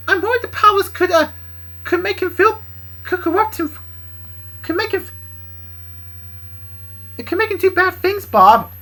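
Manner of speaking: high, loud worried character voice; worried Kendra. Acted. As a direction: plead the warning up high.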